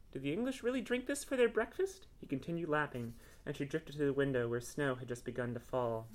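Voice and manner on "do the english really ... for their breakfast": high-pitched incredulous question; amazed question. Acted. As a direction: ask it in innocent wonder. Cat amazed at English habit.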